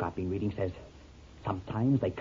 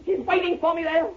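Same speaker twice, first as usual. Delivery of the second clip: much higher, rising into a shout; terrified pleading; 3 dB louder than usual. Acted. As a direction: dread of the ghost in the water. Man refuses to enter water.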